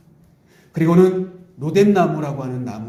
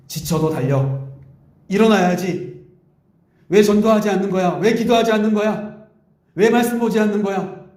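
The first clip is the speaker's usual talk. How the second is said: rising, louder pushy demands; mock scolding; 6 semitones higher, 3 dB louder than usual. Pastor voicing God as a pushy taskmaster, mock scolding. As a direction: press them hard, like a nagging boss. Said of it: "이렇게 하나님 다그치시지 않아요".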